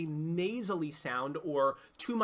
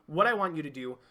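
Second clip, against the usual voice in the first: normal talking voice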